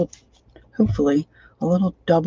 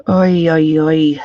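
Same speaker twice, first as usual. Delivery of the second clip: louder pained oy; hot glue burn; 7 dB louder, slower than usual. Real, not acted.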